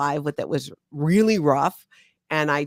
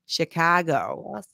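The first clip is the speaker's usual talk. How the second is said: Chicago accent; much slower than usual. Shown as a demonstration. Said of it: "Can you do a Chicago accent?".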